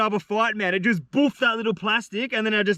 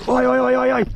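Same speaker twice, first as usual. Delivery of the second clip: shouting; 6 semitones higher, 5 dB louder than usual. Real, not acted. Fish fighting near shore.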